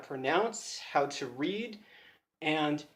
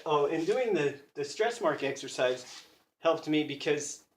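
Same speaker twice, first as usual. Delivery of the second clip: usual speaking voice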